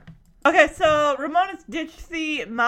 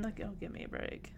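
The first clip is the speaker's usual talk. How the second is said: scoffing aside; 4 semitones lower, 18 dB quieter, faster than usual. Real, not acted. Mocking dismissal.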